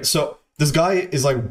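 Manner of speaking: ordinary talk, nothing marked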